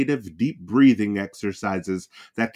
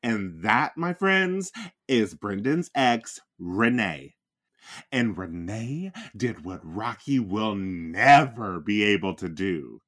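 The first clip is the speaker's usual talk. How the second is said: ordinary talk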